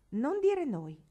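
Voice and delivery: put-on girl rejecting the shared blame, loudly; sharp exclamation. A narrator's reading. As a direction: snap back the word, shocked. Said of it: "esclamò Marigold".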